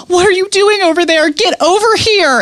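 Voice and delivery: high, exasperated mock yell; mad at husband. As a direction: imitate a laboring wife snapping at her husband. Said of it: "immediately got mad at her husband".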